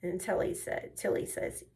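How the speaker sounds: usual speaking voice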